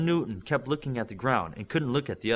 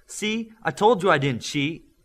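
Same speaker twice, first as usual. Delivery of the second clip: louder, gloating at the others; loudly; 7 semitones higher, 5 dB louder than usual. A narrator's reading. Olympian gloats to the boys, loudly. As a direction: crow it out loud. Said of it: "Olympian said loudly".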